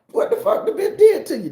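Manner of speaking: very high, loud disbelief; outraged yell. Retold or imitated. Grandson demanding what grandma did to her, very high outraged yell. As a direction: shriek it out in shock. Outraged question in the retold argument.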